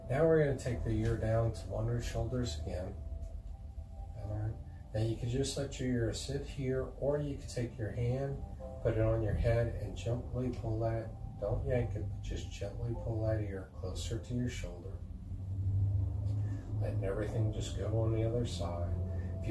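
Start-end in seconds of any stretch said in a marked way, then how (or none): none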